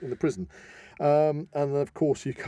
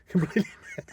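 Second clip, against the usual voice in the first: laughing echo; 7 semitones higher, much faster than usual. Retold or imitated. Echoing the gossip laughing.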